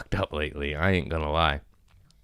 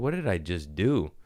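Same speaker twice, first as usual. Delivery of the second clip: ordinary delivery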